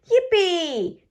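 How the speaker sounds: high, bright yippee; a little pig cheering with joy. A narrator's reading. Peppa cheering about friends coming to play. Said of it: "cheers Peppa".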